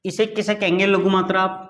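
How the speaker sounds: ordinary talk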